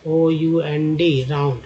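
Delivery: आरओयूएनडी slowly, letter by letter; teacher's slow spelling of आरओयूएनडी. Shown as a demonstration. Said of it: "आर लगाते हैं तो round हो जाता है".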